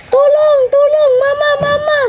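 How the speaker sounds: put-on high, loud child's cry of tulong mama; child crying for help. Child imitating a sibling's panicked cry for mama. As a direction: mimic the little kid panicking on the ride. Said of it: "Ganyan, ganyan siya".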